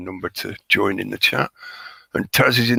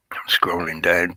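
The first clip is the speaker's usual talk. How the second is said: whispering; 2 semitones lower than usual. Real, not acted. Muttered aside while scrolling.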